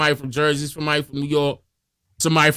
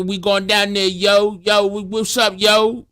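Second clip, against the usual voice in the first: higher, lively Baltimore "yo" greeting; Baltimore accent; 4 semitones higher, slower than usual. Retold or imitated. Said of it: "Be more got the yo".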